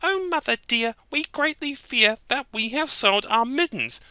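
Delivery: high timid kitten voice; worried kitten. Acted. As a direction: confess nervously like a kitten. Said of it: "they began to sigh".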